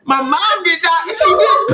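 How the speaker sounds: high, loud laughing protest; correcting the hosts, cracking up. Real, not acted. Defending her mom, laughing.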